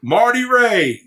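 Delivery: high, excited imitation of Rob; Rob's greeting. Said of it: "like that".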